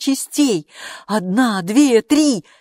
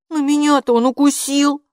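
protesting; 8 semitones higher, 6 dB louder than usual. A narrator's reading. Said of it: "запротестовал Оскар".

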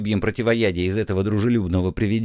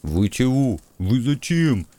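anxious, voice pitched up; nervous knight; 3 semitones higher, slower than usual. A narrator's reading. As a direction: fret like a cowardly knight. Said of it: "заволновался трусливый рыцарь".

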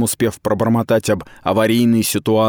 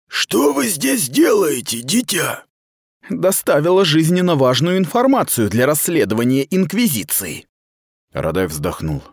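stern commander; 8 semitones higher than usual. Acted. Aradai lectures the captured girl, cold and official. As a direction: speak as a cold superior officer.

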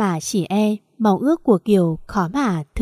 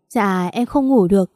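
ordinary delivery

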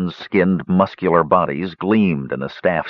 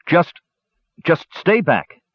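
stammered order; 9 semitones higher, slower than usual. A narrator's reading. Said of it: "Tannen ordered".

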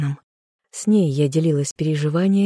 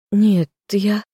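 desperate protest; 4 semitones higher, 4 dB louder than usual. A narrator's reading. Heroine protests, cut off.

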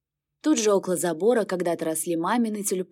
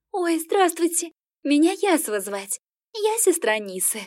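squealing with delight; 9 semitones higher than usual. Acted. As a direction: gush like a smitten girl. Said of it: "чуть не запищала от восторга".

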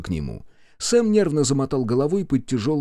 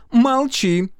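high, loud command; cutting him off; 13 semitones higher, 6 dB louder than usual. A narrator's reading. Mother shuts up her objecting son, cutting him off. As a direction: silence him at once. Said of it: "оборвала его мать".

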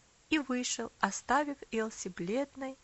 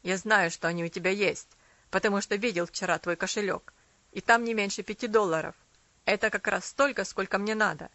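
put-on demanding boy voice; 2 semitones lower, 7 dB louder than usual. A narrator's reading. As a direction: lean on her hard. Boy Arthur demanding money.

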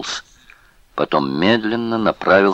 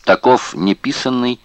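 plain reading voice

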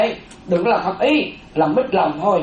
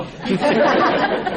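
light breathy laugh; 5 semitones higher than usual. Real, not acted. Monk laughs at the answer.

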